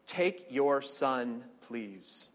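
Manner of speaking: very slow, fading on please; God asking Abraham gently; slow solemn request. Acted. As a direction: say it slowly, let please fall away. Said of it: "God said".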